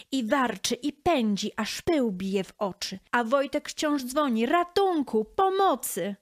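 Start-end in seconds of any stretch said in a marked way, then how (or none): 4.5-6.2 high-pitched, urgent call for rescue; cry for help. A narrator's reading. Boy rings bell, calls for help. (wysokie wołanie o pomoc)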